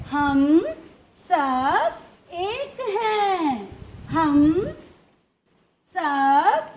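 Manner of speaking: very slow, each word stretched and set apart; drawn-out slogan. Acted. Closing the letters' quarrel for the children, slow ringing slogan. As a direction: declaim it word by word with long held vowels.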